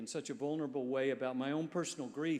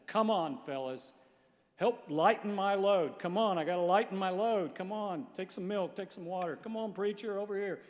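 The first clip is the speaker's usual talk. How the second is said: higher, louder cheerful calling across the room; 5 semitones higher, 5 dB louder than usual. Retold or imitated. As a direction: call the men over, bright and loud. Preacher calling out to homeless men.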